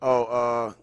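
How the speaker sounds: ordinary talk, nothing marked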